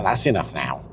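normal talking voice

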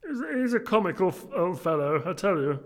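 high, loud put-on young gentleman; merry nephew. Nephew telling guests about his comical uncle, high loud voice. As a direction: pitch it high and project. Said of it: "said Scrooge's nephew".

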